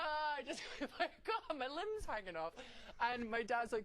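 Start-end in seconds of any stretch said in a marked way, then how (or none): 0.0-2.5 high, pained whine with a laugh; hammed-up moan of the injured; mock wailing.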